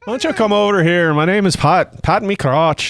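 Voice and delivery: put-on Irish accent. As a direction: play a cheesy Irish flirt. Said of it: "your Irishman".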